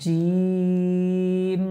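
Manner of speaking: জিম held very long, three alif lengths; drawn-out letter. Shown as a demonstration. Teacher drawing out জিম for the class. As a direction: stretch জিম out slowly. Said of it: "তিন আলিফ পরিমাণ টানবো".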